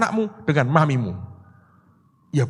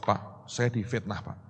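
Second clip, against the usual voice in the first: mild complaint; 4 semitones lower, 9 dB quieter than usual. Retold or imitated. Friend complaining to his pastor about slander, mildly plaintive. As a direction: quietly imitate someone complaining of slander. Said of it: "dia mengeluh".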